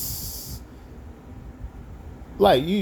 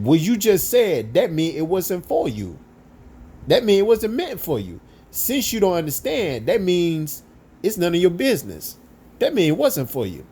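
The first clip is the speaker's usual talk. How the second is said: emphatic high lecture; 7 semitones higher than usual. Retold or imitated. Brother lectures the nosy coworker, high and insistent.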